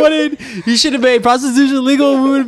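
high squeaky mock defense; high mock plea. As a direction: whine your excuse. Continuing God bit.

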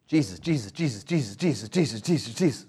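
put-on light nagging child calling Jesus over and over; a hint of a kid tugging at a sleeve; pestering child. Lightly voiced child pestering Jesus for attention.

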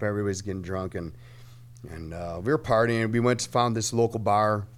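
normal talking voice

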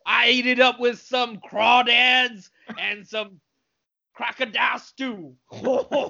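put-on hammy Louisiana cook accent; Cajun accent. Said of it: "Southern or Louisianan".

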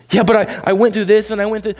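protesting objector. Retold or imitated. Objector voiced.

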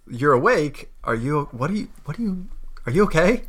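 lightly voiced startled sister; surprised concern. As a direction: check on her, caught off guard. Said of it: "she looks in and she goes".

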